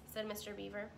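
usual speaking voice

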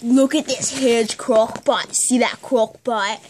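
put-on slow, broad Australian accent. Friend spotting a crocodile, slow Australian accent. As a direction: stretch it out in an Aussie accent. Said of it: "Now I'll do Australian".

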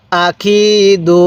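আকিদু very slow, কি held long and level; one-alif elongation. Shown as a demonstration. Teacher showing how to read, drawn-out আকিদু. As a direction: chant আকিদু, stretching the middle কি. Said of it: "এক আলিফ টানতে হয়".

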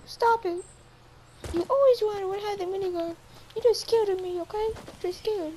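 high squeaky scolding; high-pitched mock taunt; high Elmo-like voice. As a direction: scold him in a puppet voice. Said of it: "Do I sound like Elmo?".

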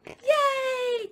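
high, bright cheer; happy cheer. Acted. Praising the children after the rhyme, high cheer. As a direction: celebrate with the kids.

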